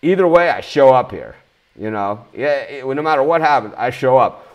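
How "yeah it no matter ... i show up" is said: ordinary talk, nothing marked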